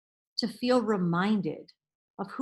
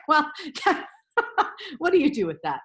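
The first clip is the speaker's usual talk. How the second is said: laughing; 3 semitones higher, faster than usual. Retold or imitated. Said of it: "I'm like".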